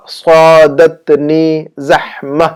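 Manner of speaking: صادتنی زحمہ slowly, with a heavy S; pronunciation model. Shown as a demonstration. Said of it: "اب ہمیں کہنا ہوگا".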